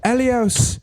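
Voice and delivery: high mock wife calling out; squeaky imitation of the wife pointing; wife's voice. Husband retold: wife urging Elios to look, high mock voice. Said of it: "your wife goes".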